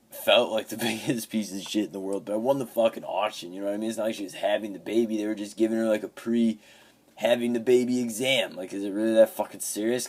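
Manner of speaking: rising outrage, voice pushed up and loud; heated rant. Real, not acted.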